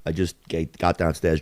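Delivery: ordinary delivery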